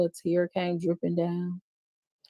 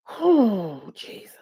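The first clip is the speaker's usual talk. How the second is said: tearful whisper; 2 semitones higher, much slower than usual. Real, not acted. Reacting to the kids' statements, tearing up. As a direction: let it catch in your throat. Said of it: "cry whisper".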